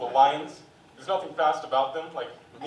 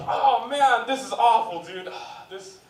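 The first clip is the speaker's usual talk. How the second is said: loud, higher mock despair; devastated gambler; 7 semitones higher, 9 dB louder than usual. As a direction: whine about losing the bet. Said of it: "people who watch fighting games competitively".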